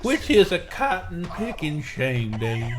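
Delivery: slow drawling cowboy with a whistle; Slim Pickens. Pretend. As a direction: whistle through the teeth, slow and high. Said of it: "I'm trying to whistle when I say it".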